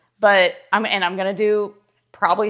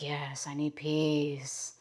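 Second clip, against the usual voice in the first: mock sigh of longing; 6 semitones lower, 12 dB quieter, much slower than usual. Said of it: "they're like".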